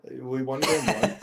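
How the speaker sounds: slow color commentator voice; relaxed sports commentary. Shown as a demonstration. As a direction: call it like a laid-back sportscaster. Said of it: "putting on my color commentator voice".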